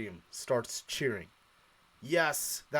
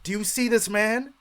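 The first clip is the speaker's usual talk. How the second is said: higher, pointed, incredulous questions; exasperated; 9 semitones higher, 4 dB louder than usual. Retold or imitated. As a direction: jab at the crowd in disbelief. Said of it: "I'm like".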